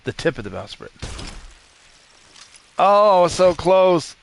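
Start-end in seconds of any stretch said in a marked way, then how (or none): 2.8-4.2 long, loud "so close"; drawn-out near-miss groan. Real, not acted. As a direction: stretch it out, gutted by the miss. Near miss shooting bowsprit.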